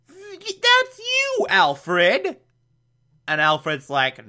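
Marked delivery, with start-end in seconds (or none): 0.1-2.4 put-on very high falsetto old-lady voice; Aunt Harriet voice. Podcaster voicing Aunt Harriet recognising Alfred, high falsetto. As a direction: squeak it out as the aunt.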